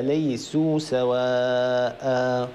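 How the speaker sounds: slow drawn-out recitation; Quran recitation. Shown as a demonstration. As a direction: recite slowly with long vowels. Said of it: "تلاوة الآية". (تلاوة مجودة ممدودة)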